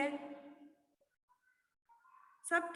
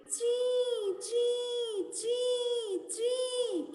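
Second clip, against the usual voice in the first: high chirping bird imitation; 5 semitones higher, much slower than usual. Shown as a demonstration. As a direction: chirp like a scared little bird. (ऊँची आवाज़ में चिड़िया की चीं चीं)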